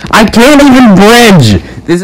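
exasperated gamer scream; frustrated yell. Real, not acted. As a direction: blow up at the game. Frustrated gamer yells same line.

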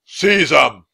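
loud commanding order; mild tyrant shout. Acted. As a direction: bark the order at soldiers. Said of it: "the Dragon King shouted".

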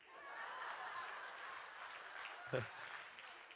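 high breathless laugh without voice; airy laugh. Retold or imitated. Imitating a passenger laughing at his answer.

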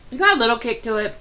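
chewing while talking; talking between bites; mouth full of chips. Real, not acted. Seen 1: Holds chip, bites it around 1.8-2.2s, then mouth busy chewing.

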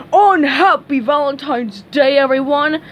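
loud, spiteful holler; yelling. A narrator's reading. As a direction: yell it as a bitter greeting. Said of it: "Patrick yelled".

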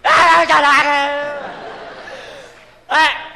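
loud, high mock wail; demonstrated baby cry. Shown as a demonstration. Singer showing partner how a baby cries, hammed-up bawling. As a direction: bawl in exaggerated sobs, like a roaring vacuum cleaner. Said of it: "nghẹn ngào coi nó khóc nè".

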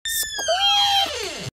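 long, high squeal that drops at the end; high squeak. Acted. Scary movie noise for young readers, long high squeak. Imitated movie sound effect.

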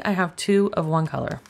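ordinary talk, nothing marked

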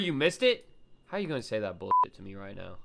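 normal talking voice

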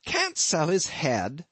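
slow, rising disbelief; incredulous. Acted. Ishmael repeating the landlord's words in disbelief, rising. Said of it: "getting into a towering rage".

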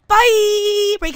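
high, loud bright goodbye; loud excited outro goodbye; cheery sign-off. Real, not acted. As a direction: call out goodbye to the viewers. Vlog sign-off.

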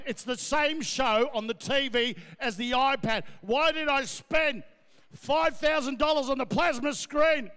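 usual speaking voice

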